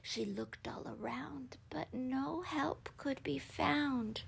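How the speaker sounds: very soft, whispery narration; hushed suspense. A narrator's reading. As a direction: hush it as the danger creeps closer. Narration hushes as dragon nears.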